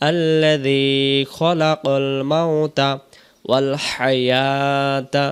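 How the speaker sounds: slow recitation, খলাকাল as rounded kho-la-qol; correct recitation. Shown as a demonstration. Teacher reciting the corrected verse, slow with heavy kho. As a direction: draw out the long vowels in আল্লাযী and হায়াতা. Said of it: "তাহলে আমরা বলবো".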